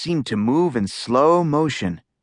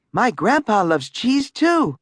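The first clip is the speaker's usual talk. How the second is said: put-on boy voice; 9 semitones higher than usual. A narrator's reading. Manny speaks in story.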